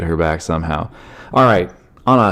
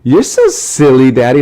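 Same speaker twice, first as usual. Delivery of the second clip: imitated amused daughter; 5 semitones higher, 5 dB louder than usual. Daughter watching daddy's stand-up video, imitated silly child voice. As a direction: tease daddy like a small child. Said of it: "And then she was like".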